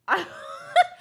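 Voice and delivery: sudden high squeal of a laugh; high burst of laughter. Real, not acted. Laughing at her own outburst, a high squeal of laughter.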